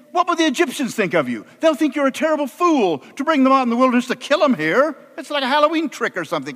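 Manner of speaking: high, fast, exasperated arguing; rapid-fire protest, slowing on the final appeal; pleading Moses. Acted.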